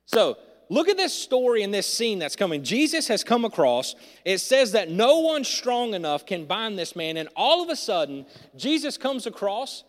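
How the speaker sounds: loud, driving sermon delivery; preaching at full force. Acted. Pastor preaching to church, loud and driving. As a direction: preach it out to the congregation.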